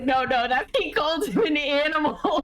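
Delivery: incredulous, laughing through it; high laughing inner voice; mock outrage with a laugh; laughing disbelief. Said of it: "more me in my head".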